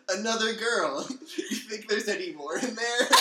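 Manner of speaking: loud, giggly line; laughing through the words; laughing while reading. Real, not acted.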